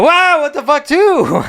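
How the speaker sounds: high, loud, reenacted freak-out; startled yell back. Tripping hiker answers a startled kid, high shout.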